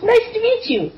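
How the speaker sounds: put-on very high, squeaky girl voice. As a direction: pitch way up for a young girl. Girl character introduces herself.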